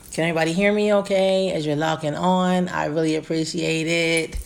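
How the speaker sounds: ordinary delivery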